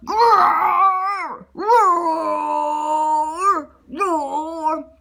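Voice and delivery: loud, long growling roar; huge drawn-out roar; contest bear roar. Acted. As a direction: roar as big as a grizzly. Boris roars in the contest.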